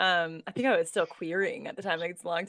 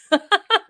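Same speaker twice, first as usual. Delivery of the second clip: bright laugh; 9 semitones higher, 7 dB louder than usual. Real, not acted. Laugh after joke.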